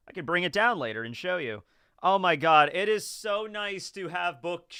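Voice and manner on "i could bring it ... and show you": usual speaking voice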